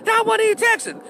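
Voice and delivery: high-pitched mock denial; imitated defensive woman. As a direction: mimic an indignant girlfriend, voice way up. Acting out woman's denial in quarrel.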